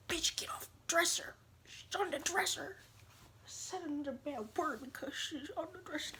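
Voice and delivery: high, hushed telling-off; mock scolding. Scolding a pet on the dresser, jokingly hushed.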